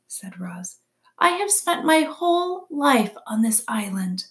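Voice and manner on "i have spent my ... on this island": high, measured robot voice; robot character. A narrator's reading. Robot tells animals where she lives, high and measured. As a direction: explain it evenly, pitched up.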